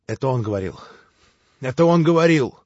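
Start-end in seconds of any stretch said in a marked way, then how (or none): 1.6-2.6 louder, higher repeat of the same words; anguished shout. Acted. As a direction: burst out insisting. Repeated as an outburst. (выкрикнуть, настаивая)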